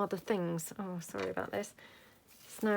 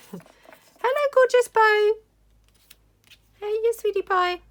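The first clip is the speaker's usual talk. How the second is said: high, loud sing-song coo to the dog; doggy talk; 14 semitones higher, 11 dB louder than usual. Real, not acted. Greeting the dog.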